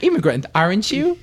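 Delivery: high, prim phone voice; high smug assistant voice; mock Siri voice. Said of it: "she's like".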